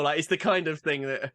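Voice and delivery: ordinary talk, nothing marked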